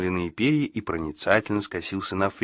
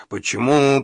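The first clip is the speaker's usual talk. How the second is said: sorrowful sigh; 6 semitones higher, 5 dB louder than usual. A narrator's reading. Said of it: "выразив вздохом великую скорбь".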